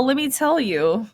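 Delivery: ordinary delivery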